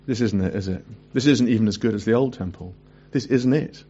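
low, let-down voice of the weeping elders; a hint of sorrowful disappointment; disappointed lament. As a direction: lament that the temple falls short. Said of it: "Why are they weeping?".

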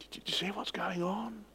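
breathy hush to the congregation; whisper. Acted. Preacher whispering to the congregation, hushed. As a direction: lean in and whisper the question.

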